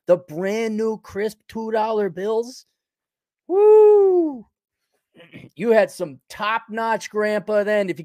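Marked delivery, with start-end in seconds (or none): 3.5-4.4 high, loud joyful whoop; excited whoop. Real, not acted. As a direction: let out a thrilled woo. Excited about two dollar bills.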